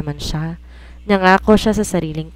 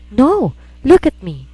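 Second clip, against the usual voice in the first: desperate cry; 7 semitones higher, 6 dB louder, slower than usual. Acted. Desperate woman demanding attention.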